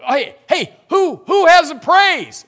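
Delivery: loud, high, slow call for praise; rally the crowd for a praise; mock service leader. Preacher pretending to invite praise in the service.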